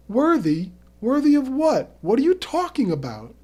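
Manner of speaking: high, loud disbelief; can't believe what he's hearing; incredulous questions. Acted. Angler to Terry about being worthy, incredulous questions.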